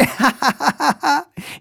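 éclat de rire aigu de fillette; rire d'enfant. A narrator's reading. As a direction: rire à gorge déployée, aigu. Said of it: "riant à gorge déployée". (la petite sœur éclate de rire)

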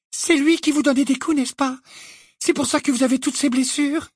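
put-on very high, indignant young witch; shrill accusation. A narrator's reading. Hermione accuses Hagrid of hiding who hurt him, shrill. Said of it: "d'une voix suraiguë".

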